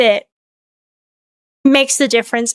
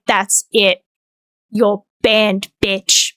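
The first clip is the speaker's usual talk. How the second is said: low, curt pretend threat; mock stern ban; 7 semitones lower, much slower than usual. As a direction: drop low and lay down the law. Imagined stern streamer banning someone.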